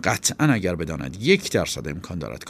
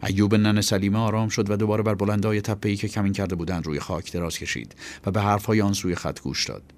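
plain reading voice

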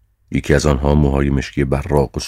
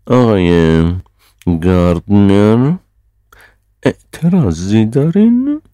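loud, drawn-out, rising challenge; put-out chairman, loud and incredulous; upset and surprised; 5 semitones higher, 6 dB louder, slower than usual. A narrator's reading. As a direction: stretch each word, climbing to a high question. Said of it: "ناراحت و متعجب بود".

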